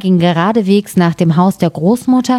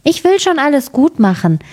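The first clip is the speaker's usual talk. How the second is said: put-on little girl's promise; 8 semitones higher than usual. A narrator's reading. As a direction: promise it in a high child voice.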